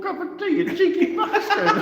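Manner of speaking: laughing insult to a mate. Real, not acted. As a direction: squeal it out between laughs. Cracking up at a joke.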